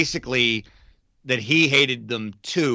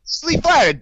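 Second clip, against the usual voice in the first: much higher, louder, mock squeaky flattered voice; 5 dB louder than usual. Host teases his cohost in a mock squeaky, flattered voice. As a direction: squeak it out like a giddy fan.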